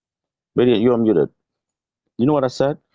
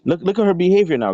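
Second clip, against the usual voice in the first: usual speaking voice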